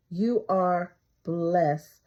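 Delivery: ordinary talk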